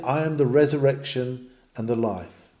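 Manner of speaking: usual speaking voice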